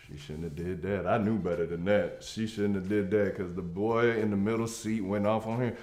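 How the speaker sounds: ordinary delivery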